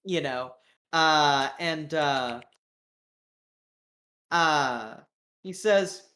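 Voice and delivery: ordinary delivery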